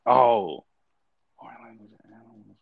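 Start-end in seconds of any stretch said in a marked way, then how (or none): 1.4-2.6 very soft, quick undertone; to himself. Real, not acted. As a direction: murmur it privately while thinking. Murmuring options to himself while thinking.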